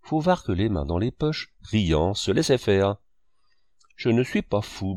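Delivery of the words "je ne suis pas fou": ordinary delivery, nothing marked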